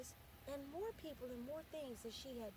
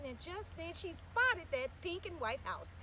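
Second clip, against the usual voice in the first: usual speaking voice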